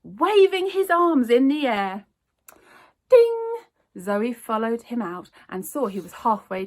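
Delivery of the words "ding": high, loud bell ding; imitated shop bell. A narrator's reading. Narrator voicing the door bell as Raj runs out, ding.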